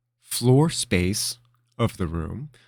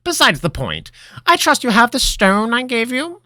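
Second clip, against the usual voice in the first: high, loud wizard voice; brisk like a wizard master; old wizard voice; 9 dB louder than usual. Acted. Master moves on.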